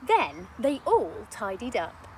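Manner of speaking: plain reading voice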